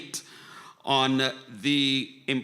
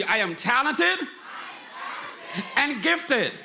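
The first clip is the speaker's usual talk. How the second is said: more energy; 7 semitones higher, slower than usual. Shown as a demonstration. Host urging the audience to chant with more energy. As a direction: pump it up, get the room going. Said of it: "say it with some more energy".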